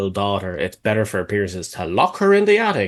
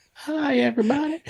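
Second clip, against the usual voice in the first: high squeaky cartoon greeting; Muppet voice; 3 dB quieter than usual. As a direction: chirp it like a puppet.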